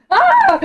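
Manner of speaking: high shriek breaking into laughter; scream, then laugh at yourself. Real, not acted. Jump scare in horror game.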